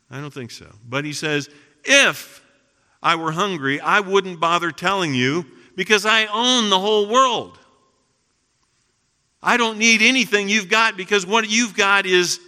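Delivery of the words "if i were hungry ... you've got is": loud, high, declamatory voice of God; emphatic God. Acted. God telling worshippers he owns the world, loud and emphatic. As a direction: proclaim it forcefully, punching the opening "If". God quoted scorning offerings.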